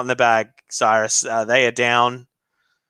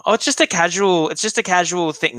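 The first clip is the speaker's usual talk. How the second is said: lightly voiced girlfriend, a bit higher; imagined girlfriend; 4 semitones higher than usual. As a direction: play down the dinner as a lightly imitated girlfriend. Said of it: "if my girlfriend came to me and was like".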